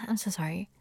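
ordinary talk

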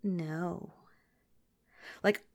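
ordinary talk

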